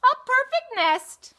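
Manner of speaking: high, loud southern goose cry. Acted. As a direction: belt it out like a brash farm goose. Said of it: "she cried".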